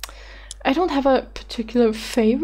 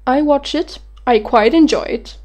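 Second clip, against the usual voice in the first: ordinary talk, nothing marked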